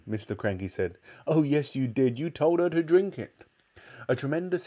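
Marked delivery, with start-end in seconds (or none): 1.2-3.4 raised, insistent voice; accusing. A narrator's reading. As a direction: pin the blame on him. Wife accuses her husband.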